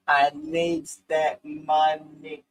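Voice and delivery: very slow, drawn-out movie voice; hammed-up slow movie quote; Vivica impression. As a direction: stretch every word like Vivica in Set It Off. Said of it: "You actually look like her".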